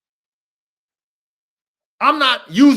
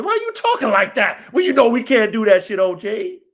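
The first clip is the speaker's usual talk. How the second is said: louder, forceful questioning; heated challenge; 5 dB louder than usual. Acted. Coach calling out the OG's talk, heated challenge. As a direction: demand it loudly, with indignation.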